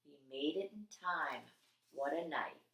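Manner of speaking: soft, breathy relief; whisper. A narrator's reading. Dog narrator whispering relief at reaching the hospital. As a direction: whisper it like a secret success.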